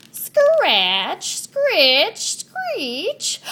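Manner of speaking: high loud drawn-out screech; spooky rising screech; eerie screech sound. Acted. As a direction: wail the screech like a ghost noise. Imitating a scary noise.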